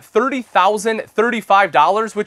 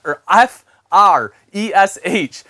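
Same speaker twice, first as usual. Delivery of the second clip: careful spelling; slower than usual. Real, not acted. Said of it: "I can't spell fresh today".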